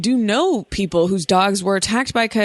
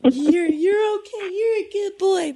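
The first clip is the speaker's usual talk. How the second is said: soothing the dog; 12 semitones higher than usual. Retold or imitated. Reassuring the dog after yelling.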